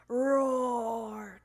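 loud, long, drawn-out roar; dinosaur roar. A narrator's reading. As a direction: roar like a pretend T-Rex. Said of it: "cried Froggy".

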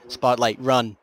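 loud alarmed warning; urgent shout. Real, not acted.

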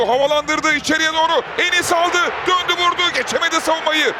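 loud, thrilled play-by-play shouting; screaming football commentator. Real, not acted. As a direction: roar out a stunning goal. Second goal call, live commentary.

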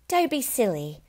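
a lightly voiced scolding wife; wife's voice. A narrator's reading. Wife brushing off her husband, lightly voiced. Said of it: "his wife said".

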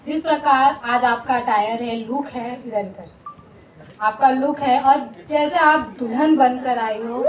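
ordinary delivery

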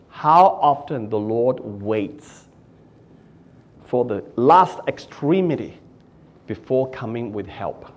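measured quotation from the commentary; quoted reading. Shown as a demonstration. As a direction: read the commentary slowly and gently. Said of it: "Phillips writes in the commentary".

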